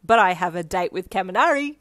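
loud, self-satisfied announcement; smirking. A narrator's reading. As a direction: boast through a smirk. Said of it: "you smirked".

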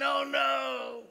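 high, loud mock panic; mock panicked wail. Youth group freaking out over the coming, panicked wail. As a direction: cry it out like a panicked teen. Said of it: "crying, wailing".

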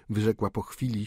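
usual speaking voice

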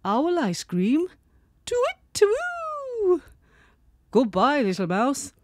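high hooting cartoon owl; cartoon bird voice. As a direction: hoot it out like a startled owl.

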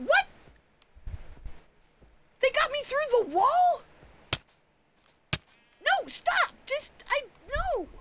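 dragged through the wall by a bear, panicked high squeals. Real, not acted. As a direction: shriek in real panic as you're dragged off. Bear drags her through wall.

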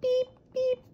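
high robotic "Beep, beep". Acted. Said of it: "twin robots".

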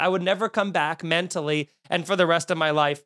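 normal talking voice

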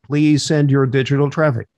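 ordinary talk

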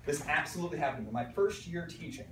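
ordinary delivery

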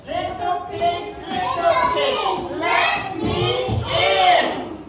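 slow sing-song wolf call. Acted. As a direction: stretch it out like a sly wolf. Wolf asks pig again.

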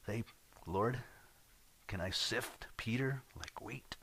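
a scheming whisper asking permission; sly Satan whisper. Acted. Satan voiced asking God.

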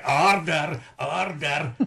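drawn-out, slow Scots "order"; Scots accent. Acted. Actor mimicking Parliament's Scottish Speaker, drawn-out Scots accent. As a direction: imitate the Speaker's broad Scots, stretching every word. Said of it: "who speaks like that".

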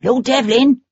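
lightly voiced high, anxious man calling out; high-pitched anxious call. A narrator's reading. Man in a hackney calls anxiously to Lord Devlin. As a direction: call his name up high, worried. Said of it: "a man's high-pitched, anxious voice".

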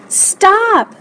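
high-pitched cry to halt; urgent cry. A narrator's reading. Lewis halts the swim team, crying out. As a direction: call out sharply, pitched high. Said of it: "cried Lewis".

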